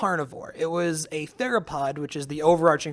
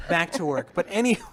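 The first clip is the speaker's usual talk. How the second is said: ordinary talk, nothing marked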